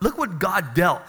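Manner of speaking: normal talking voice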